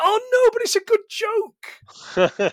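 high, drawn-out wail of protest; pained mock protest. Host reacting to an over-explained joke, pained mock protest. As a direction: stretch it out high, playfully pained.

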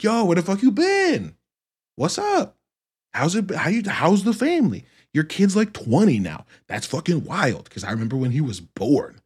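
put-on high, loud, delighted hello to an old friend; overjoyed greeting. Greeting a returning friend with a big happy voice. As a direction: beam and throw it out warmly. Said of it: "instead of being like".

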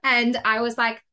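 ordinary talk, nothing marked

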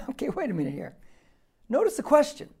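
ordinary talk, nothing marked

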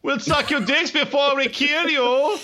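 badly faked Japanese-turned-German accent; muddled Japanese-German accent. Enemy soldier calling to American boys, hammed-up Japanese-German accent. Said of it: "I thought that was a Japanese accent".

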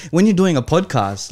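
normal talking voice

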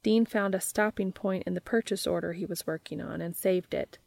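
ordinary delivery

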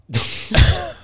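short loud high-pitched laugh; real loud giggle. Real, not acted. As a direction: burst out laughing at your own joke. Laughs at own joke.

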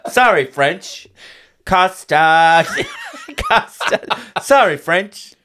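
high, loud and laughing; laughing mock quote. Goofing for a TikTok with her friend, laughing loudly. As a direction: giggle through it like a TikTok skit. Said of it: "Can I have a TikTok of us going".

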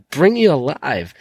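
usual speaking voice

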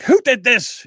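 high, loud demanding shout; chef yelling. Retold or imitated. Imitating a screaming chef in the kitchen. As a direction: bellow at the kitchen staff. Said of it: "he's screaming and yelling at people".

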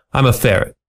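ordinary delivery, nothing marked

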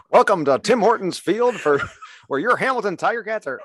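high, loud sportscaster welcome; R J Broadhead voice. Acted. Player fakes a play-by-play man opening the game, high announcer voice. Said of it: "put on my R J Broadhead voice".

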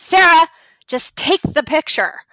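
imitated angry yell, high and loud. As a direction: yell the name, then the order. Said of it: "he just yells at me".

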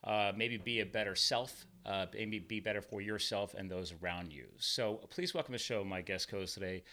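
ordinary delivery

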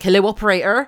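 loud mock West Country Wurzel burr; Wurzel accent. Correcting her phone-call mimicry, Wurzel accent. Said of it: "a bit more Wurzel, like".

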